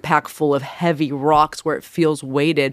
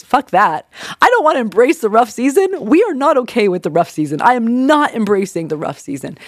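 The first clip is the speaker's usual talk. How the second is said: louder, higher mock protest; stubbornly refuse, half-joking; defiant inner voice; 6 semitones higher, 3 dB louder than usual.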